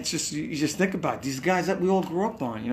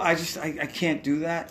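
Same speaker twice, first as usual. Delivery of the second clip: ordinary talk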